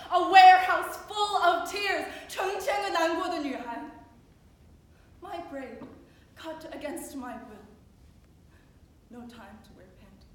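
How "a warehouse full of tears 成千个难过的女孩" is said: raised, much louder dramatic cry; impassioned, high and forceful recital; anguished outburst. Acted. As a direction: burst out loudly in grief. Anguished burst within testimony poem.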